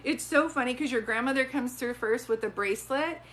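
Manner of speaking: ordinary talk, nothing marked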